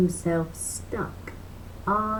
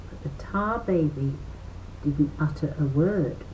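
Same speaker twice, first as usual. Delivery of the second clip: plain reading voice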